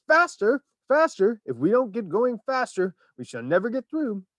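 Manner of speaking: high, loud urging; urgent cry. A narrator's reading. Wonka banging the wall, urgent high cry. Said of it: "cried Mister Wonka".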